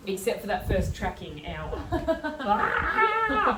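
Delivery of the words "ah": high loud laugh then groan. Real, not acted. Laughing groan at tracking hour.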